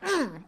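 short, soft mimicked grunt; doctor's grunt. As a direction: grunt briefly, imitating a doctor pulling. Imitates doctor pulling patient.

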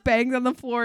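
ordinary talk

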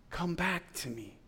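a soft voiced murmur, gentle; soft plea. God calling his child back, softly voiced. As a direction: let it linger, tender and quiet. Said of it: "God gave himself in love, saying".

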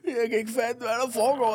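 high, whiny half-crying; mock tearful. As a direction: whimper it like a tipsy crybaby. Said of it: "smågræder lidt".

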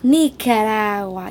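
"Nicaragua" slowly, with no hard G, ending in -awa; silent G. Shown as a demonstration. Speaker teaching friends "Nicaragua" with the G silent, slowly. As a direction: drop the G in "Nicaragua", drawn out. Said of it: "you can't pronounce the G".

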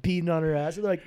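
ordinary talk, nothing marked

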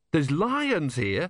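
slow, high incredulous question; alarmed disbelief. Acted. Alarmed at news of lions.

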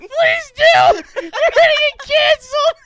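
high, loud, cracking with laughter; laughing shout. Real, not acted. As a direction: shriek it through a fit of laughing. Friend dares him to do accent.

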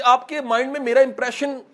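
ordinary talk, nothing marked

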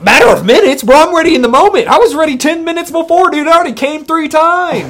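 crank the brag way up, mock-outraged; mock boast. Mock bragging one-upping friend.

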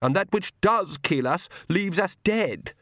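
high, slow snarled retort. A narrator's reading. Lacrimosa throws the Count's saying back at him, snarling. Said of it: "snarled Lacrimosa".

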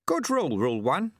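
put-on high, chirpy old man's voice; amused little old man teasing; old sweeper. A narrator's reading. Old sweeper gently mocks the shamed challenger, high and chirpy.

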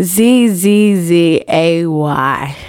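slow letter-by-letter spelling. Real, not acted. Promoter answering how to spell it, slow spelling. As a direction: spell it out slowly, one letter at a time. Asked how the name is spelt.

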